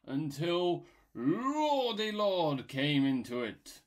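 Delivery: slow, loud, booming epic narration; grand storyteller. Acted. Mock creation tale told grandly and slowly. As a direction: announce the creator like a myth.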